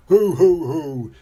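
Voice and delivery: loud, hooting "hoo hoo" chuckle. A narrator's reading. Daddy Pig has mended the toy, hooting chuckle. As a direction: chuckle in loud hoots, easing off. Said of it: "chuckles Daddy Pig".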